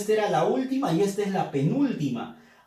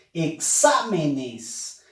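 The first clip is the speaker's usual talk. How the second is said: "Exámenes" muy lento, alargando "xá"; acento en "xa"; 4 semitones lower, much slower than usual. Shown as a demonstration. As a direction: estira la sílaba fuerte "xá" de "Exámenes". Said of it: "Fuerza de voz en xa". ("Exámenes" pausado, con énfasis en "xá")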